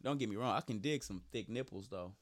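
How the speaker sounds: ordinary talk, nothing marked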